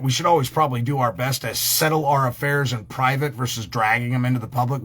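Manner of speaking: ordinary delivery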